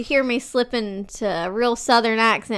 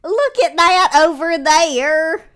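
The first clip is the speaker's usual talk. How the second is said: slow, loud, high Southern drawl; imitating a thick childhood Southern accent; childhood Southern twang; 5 semitones higher, 7 dB louder, slower than usual. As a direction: exaggerate a Southern kid's twang. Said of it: "the videos of when I was a kid".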